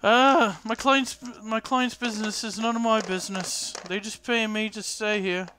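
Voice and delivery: high-voiced crotchety old woman, unhelpful; crotchety old innkeeper. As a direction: play the old landlady brushing off questions.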